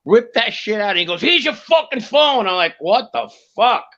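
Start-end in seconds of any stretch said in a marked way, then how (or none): 1.2-2.5 slow, high mock shout; hammed-up retold yell; mock aggressive stranger. Acting out man handing over phone. 2.8-3.8 slow, higher-pitched stunned reaction; drawn-out, incredulous retelling; retold disbelief. Acting out his own stunned reaction.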